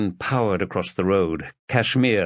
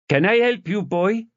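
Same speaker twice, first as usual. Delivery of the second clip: put-on thick Jamaican accent, pitched up high for the neighbour; 12 semitones higher than usual. Elderly Jamaican neighbour questions the detective, thick Jamaican accent. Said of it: "Thick Jamaican accent".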